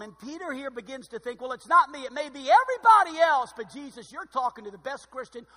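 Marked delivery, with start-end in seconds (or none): 1.4-5.5 loud, high self-defence; proud protest. Pretend. Preacher voicing Peter, boasting he's fine, loud and high. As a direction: insist loudly it's everyone else.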